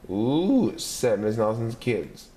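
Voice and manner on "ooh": high, drawn-out ooh of awe; a kid's wide-eyed ooh; impressed gasp of a class; awed ooh. Acted. Kids react in awe.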